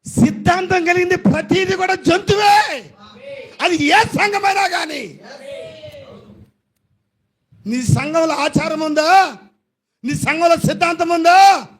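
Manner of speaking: high, loud pulpit shouting; fiery sermon shout; preaching at full force. Acted. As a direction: hammer each charge at the congregation.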